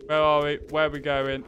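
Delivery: ordinary talk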